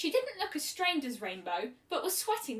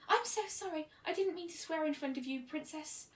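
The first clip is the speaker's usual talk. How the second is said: flustered shy voice; 6 semitones higher, 4 dB quieter than usual. A narrator's reading. Fluttershy flustered apology.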